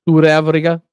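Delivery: put-on South African accent. Host trying a South African viewer's pronunciation, South African accent. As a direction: imitate a South African saying the country's name. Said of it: "say that in your accent".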